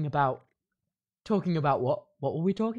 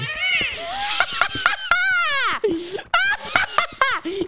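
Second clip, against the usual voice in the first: high, wailing fit of laughter; long cackling laugh. Acted. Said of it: "I'm not laughing".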